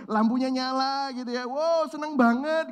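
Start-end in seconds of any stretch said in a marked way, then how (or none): 1.4-2.7 mock delight. Acting joy when lights return.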